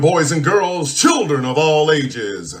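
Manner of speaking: booming showman announcer voice; grand entrance announcement. Acted. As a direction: announce it like a big show opener. Show opening announcer intro.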